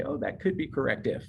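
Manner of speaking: second-guessing. Retold or imitated. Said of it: "you might look at something and say".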